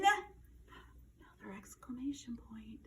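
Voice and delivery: slow unvoiced whisper. Real, not acted. Teacher whispering an aside to the class. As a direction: slip it in as a secret aside.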